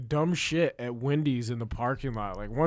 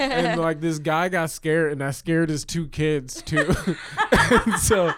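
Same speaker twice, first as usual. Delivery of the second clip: ordinary talk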